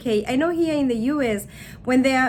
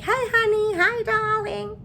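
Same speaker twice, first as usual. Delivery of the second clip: mock sweet-talk; 10 semitones higher than usual. Greeting a stranger with endearments, mock sweet-talk. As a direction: coo it in a put-on high voice.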